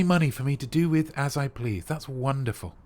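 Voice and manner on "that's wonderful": usual speaking voice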